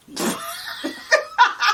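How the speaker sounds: short high-pitched burst of laughter. Real, not acted. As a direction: squeak out a laugh. Laughing at husband's offer.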